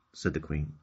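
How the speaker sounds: usual speaking voice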